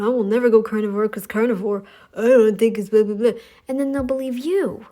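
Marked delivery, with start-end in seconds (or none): 0.0-3.3 mock dismissive quote. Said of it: "people like you going".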